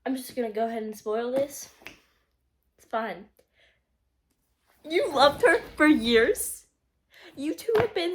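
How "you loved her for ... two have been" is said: high, loud, slow incredulous cry; stunned disbelief. Real, not acted. Reader gasping at Sam's confession, high and slow. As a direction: drag it out in shocked delight.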